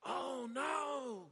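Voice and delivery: high alarmed "oh no"; a servant seeing the army, panicking; panicked cry. Retold or imitated. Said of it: "he goes".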